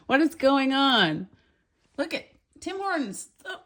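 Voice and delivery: stunned delight; amazed surprise. Real, not acted. Shopper notices the coffee is roasted in Canada, amazed.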